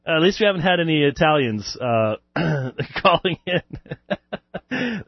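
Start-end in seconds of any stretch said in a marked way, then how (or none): 3.0-5.0 slow words broken by laughter; laughing. Real, not acted. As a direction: chuckle through the words. Laughing at the idea of Italian callers.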